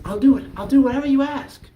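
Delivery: put-on loud, high, impassioned young lad; eager boy. Boy begging fisherman to take him as apprentice, eager. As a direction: burst in with total eagerness.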